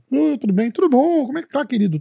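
high, syrupy customer-service greeting; sugary clerk. Retold or imitated. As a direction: play the overly sweet receptionist. Mimicking the sweet consulate clerk.

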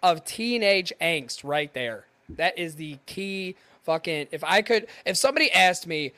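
ordinary delivery

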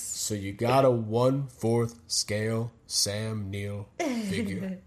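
slow, low wrestling promo drawl; Scott Hall. Pretend. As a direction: cut a lazy heel promo, slow and low. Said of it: "That's such a bad Scott Hall impression".

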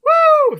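loud, high celebratory woo; cheering whoop. Real, not acted. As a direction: let out a big high whoop for the new supporter. Cheering a new patron.

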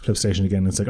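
ordinary talk

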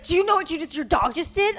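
high, mock-outraged scolding; scolding scream. Retelling a lady scolding a dog owner, mock scream. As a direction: imitate an angry stranger telling someone off. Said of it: "screaming at me".

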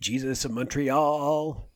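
slow, stretched Montreal; drawn-out title echo. Pretend. Co-host echoes the movie title, slow and drawn out. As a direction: draw out the last word of "Jesus of Montreal".

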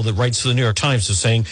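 normal talking voice

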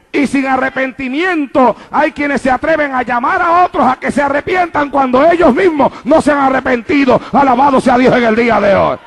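driving, repetitive pulpit cadence; preaching at full force. Real, not acted. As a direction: hammer each phrase to the congregation. Predica a plena fuerza. (prédica enérgica a plena voz)

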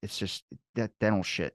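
ordinary delivery

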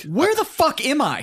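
high, slow mock bewilderment; bewildered. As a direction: stretch it out in comic disbelief. Bewildered reaction on day two.

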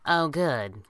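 dry, unimpressed delivery; deadpan. A narrator's reading. Kit reacting to being sealed in, deadpan. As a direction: say it with understated resignation. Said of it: "Kit deadpanned".

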